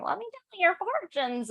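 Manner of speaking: high put-on witch voice. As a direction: croon it like a fortune-telling witch. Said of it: "Is that your witch voice?".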